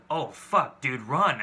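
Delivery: loud, urgent oh fuck dude run; urgent warning. Retold or imitated. Stranger telling her to flee the proposal, loud and urgent. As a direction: warn her like a stranger who sees danger.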